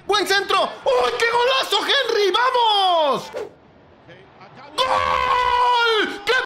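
very high, shouted goal call; breakneck goal commentary. Real, not acted. Reacción a gol de Henry. (narración de gol a gritos)

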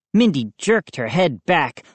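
usual speaking voice

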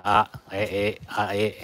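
hesitant tries at a vowel between あ and え; æ attempt. Shown as a demonstration. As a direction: grope for the sound between あ and え. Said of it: "あとえの間の発音するんだよ". (「あ」と「え」の間の音を手探りで)

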